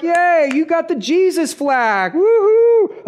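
sarcastic slow cheer ending in a high whoop; mock cheer. Nobody cheering new believers, mock high woohoo. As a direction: fake celebration, hammed-up. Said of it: "Nobody's gonna be like".

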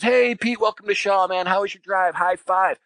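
high, hearty welcome in a buddy's voice; acted-out cheery hello; hearty greeting.

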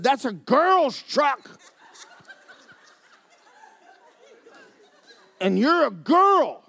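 high, slow sneering taunt. Retold or imitated. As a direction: taunt him in a high mocking voice. Mocking redneck taunt retold.

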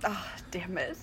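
frustrated whisper. Real, not acted. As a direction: hiss it under your breath, caught out. Caught out by a wrong guess.

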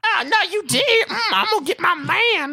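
high-pitched sassy church lady voice; sassy lady, hammed up high. Imitating sassy church woman.

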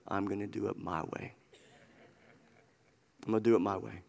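low, soft mock resolve; quiet self-will. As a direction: murmur it low, imitating stubborn insistence. Quoting stubborn people, dropped voice.

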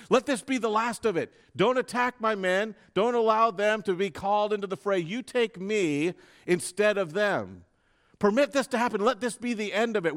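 Jesus ordering the armed crowd to let his men go, commanding; commanding plea. Retold or imitated. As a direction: hold back a mob with your voice. Commanding the mob to stop.

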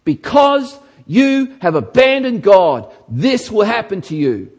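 loud, urgent pulpit warning; preaching at full force. Acted. Preacher voicing Jeremiah, loud and urgent. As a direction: thunder the prophecy at the congregation.

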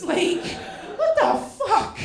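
ordinary delivery

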